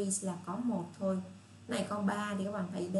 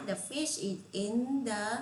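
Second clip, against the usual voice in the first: slow model sentence; 2 semitones higher, much slower than usual. Shown as a demonstration. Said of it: "cái miệng mình nói".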